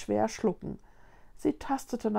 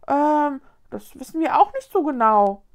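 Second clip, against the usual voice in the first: louder, higher, puzzled admission; at a loss; 5 semitones higher, 8 dB louder than usual. A narrator's reading. Ghost girl has no answer for Linus, at a loss. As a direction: admit cluelessness in a raised voice. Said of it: "fasste sich ratlos".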